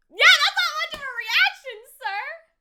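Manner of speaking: loud, high-pitched incredulous exclamation. Real, not acted. Viewer to unsurprised lottery winner, incredulous outburst. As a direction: call him out loudly in disbelief. Exclaims at character's small reaction.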